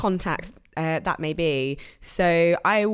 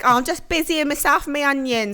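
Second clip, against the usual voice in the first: lightly put-on Cockney reply, higher; EastEnders voice; 8 semitones higher than usual. Playing the second EastEnders character, light higher Cockney. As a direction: answer like a soap character, half-hearted. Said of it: "something they'd say in EastEnders".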